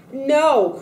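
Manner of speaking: loud, high cry of despair; Henry crying out at the news of his sold family, loud high anguish. Acted. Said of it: "cried Henry".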